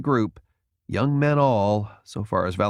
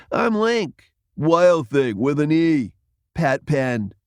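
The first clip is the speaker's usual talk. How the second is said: cheerful introductions; 5 semitones higher, 4 dB louder, slower than usual. A narrator's reading. Boys introduce themselves.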